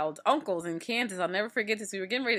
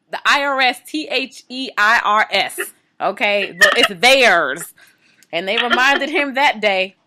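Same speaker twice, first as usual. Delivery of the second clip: loud, punched-out spelling and payoff; big, loud emphatic delivery; emphatic punchline; 14 dB louder than usual. Real, not acted. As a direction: hammer it home, much louder. Emphatic spelling out of IRS joke.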